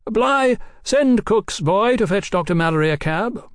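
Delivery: high-pitched call to a servant; lifted, carrying summons. A narrator's reading. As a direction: call the order out to another room. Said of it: "Oliphant lifted his voice".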